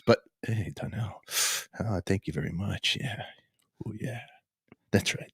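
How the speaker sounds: lightly done smooth radio host. Pretend. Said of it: "listen to radio voice".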